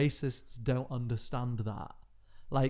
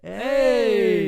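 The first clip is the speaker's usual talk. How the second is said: hearty hey; 10 semitones higher, 11 dB louder than usual. Retold or imitated. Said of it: "he gave me the".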